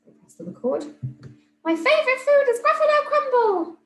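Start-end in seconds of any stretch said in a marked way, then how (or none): 1.6-3.8 very high, loud tiny squeaky mouse voice; squeaky little mouse. Acted. Teacher re-records the mouse's line, very high squeaky mouse voice. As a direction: chirp it out like a cartoon mouse. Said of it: "squeaky little mouse voice".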